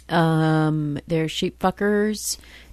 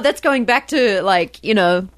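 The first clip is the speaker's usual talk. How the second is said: ordinary talk, nothing marked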